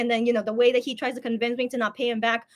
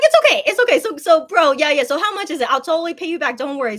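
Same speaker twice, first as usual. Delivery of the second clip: flustered insisting; 4 semitones higher, 7 dB louder than usual. Retold or imitated. Insisting on repaying brother.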